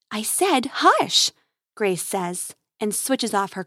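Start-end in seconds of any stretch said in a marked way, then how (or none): 0.1-1.3 slow, higher, emphatic shushing; insistent hushing. A narrator's reading. Grace silences her robot companion, insistent hushing. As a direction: firmly shush a nagging companion.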